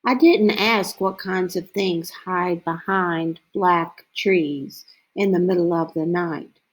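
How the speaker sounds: ordinary delivery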